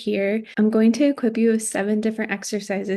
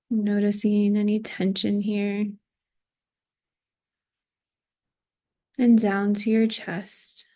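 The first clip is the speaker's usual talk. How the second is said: guided body scan. Acted. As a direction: guide them gently through the body, phrase by phrase. Leads a body scan meditation.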